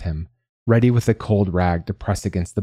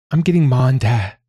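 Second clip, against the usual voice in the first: higher, worried announcement; urgent alarm; 5 semitones higher, 4 dB louder than usual. Acted. Manya going for the parents, urgent. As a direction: say it urgently, pitched up.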